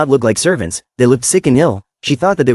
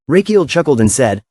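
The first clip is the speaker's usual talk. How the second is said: ordinary delivery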